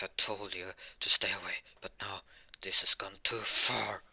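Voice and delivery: harsh half-whispered threat; acted rage, whispery and rough; menacing warning. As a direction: rasp it out with little voice, pushing the last words. Enraged character gives final warning.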